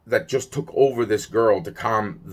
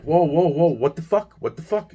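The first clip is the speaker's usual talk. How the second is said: high-pitched startled protest; alarmed disbelief; 7 semitones higher than usual. Retold or imitated. Telling of being suddenly hit and slapped, high alarmed protest. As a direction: act out being caught off guard, pitch climbing.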